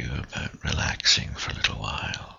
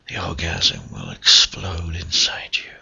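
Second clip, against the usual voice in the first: normal talking voice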